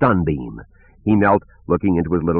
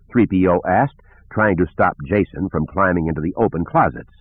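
ordinary delivery, nothing marked

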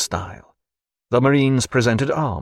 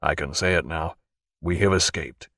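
murmured; 6 semitones lower, 5 dB quieter than usual. Acted. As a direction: lower and much softer, close to the ear. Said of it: "Jack murmured".

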